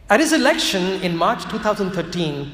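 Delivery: usual speaking voice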